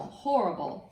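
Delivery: ordinary delivery, nothing marked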